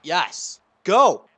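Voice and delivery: sudden cheering shout. Real, not acted. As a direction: cheer on something off-mic at full voice. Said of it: "Sorry, back to the story".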